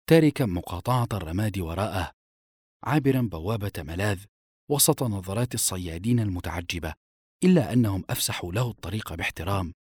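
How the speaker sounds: usual speaking voice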